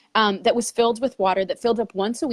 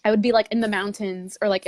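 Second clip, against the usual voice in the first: usual speaking voice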